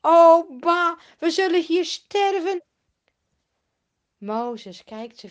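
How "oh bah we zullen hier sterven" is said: higher, much louder despairing whine; dramatic grumbling. Acted. Grumbling Israelites complaining to Mozes, loud and despairing. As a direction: wail that all is lost. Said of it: "nog meer mopperen".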